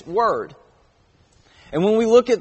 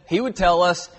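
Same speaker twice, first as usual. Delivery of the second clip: ordinary talk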